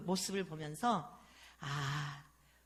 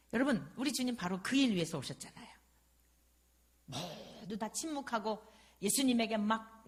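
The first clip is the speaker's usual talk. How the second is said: ordinary delivery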